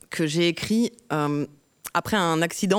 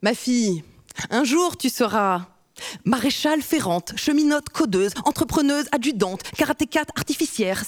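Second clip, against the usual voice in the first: rhythmic spoken-word delivery; slam poetry; 5 semitones higher than usual. Acted. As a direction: perform it like a slam poem. Performs her slam poem.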